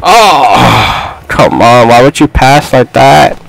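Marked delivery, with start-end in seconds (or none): none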